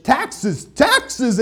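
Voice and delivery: high, gleeful cry of a greedy prince; excited. Acted. Prince gloating in his carriage over taxes, excited. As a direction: crow it out with delight. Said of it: "he's really excited".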